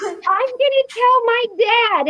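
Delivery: high, slow whiny tattletale; mock the bully's whine, up high; whiny kid. Bully whining after slap.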